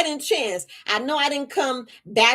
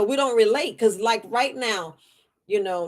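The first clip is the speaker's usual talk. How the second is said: ordinary talk, nothing marked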